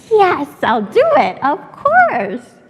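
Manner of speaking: eager, loud retold agreement; excited yes. Father accepting the restaurant deal, excited and loud. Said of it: "So he was so excited".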